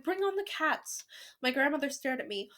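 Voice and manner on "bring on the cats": high, drawn-out rallying cry; gleeful storybook rallying call; excited shout. A narrator's reading. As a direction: call for them slowly, pitched high. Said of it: "I shouted".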